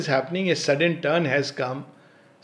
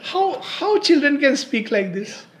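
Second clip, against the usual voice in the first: high-pitched wondering question; real amazement; 10 semitones higher, 5 dB louder than usual. Real, not acted. Speaker marvelling at how children speak, amazed. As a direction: marvel aloud, voice lifted high.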